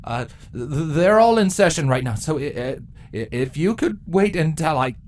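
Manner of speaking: high, loud, stuttering panic; flustered drone babbling at his queen; nervous stammer. Acted. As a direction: stumble over every word in fear.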